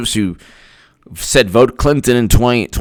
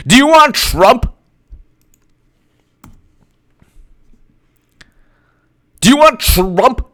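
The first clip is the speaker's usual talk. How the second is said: high, insistent mock pleading; pundits' turnabout; 10 semitones higher than usual. Pundits warn about Trump, high mock insistence. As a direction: argue it in a high put-on voice. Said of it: "they'll be like".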